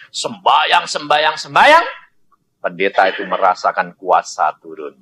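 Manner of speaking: ordinary talk, nothing marked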